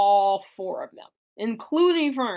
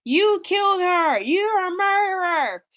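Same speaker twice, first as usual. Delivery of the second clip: higher, louder, slow jeering accusation; crowd accusing; 9 semitones higher, 3 dB louder, slower than usual. Retold or imitated. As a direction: hurl it at her like an angry mob.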